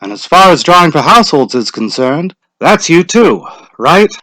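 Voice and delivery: a hint of a village official calling out; lightly voiced lottery official; Mr. Summers' voice. A narrator's reading.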